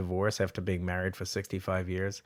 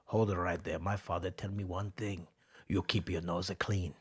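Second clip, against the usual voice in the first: put-on low, soft Italian-accented old man; comic low-voiced Italian husband; 5 semitones lower, 8 dB quieter than usual. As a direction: lay down father's advice low and quiet. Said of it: "brings the guy in".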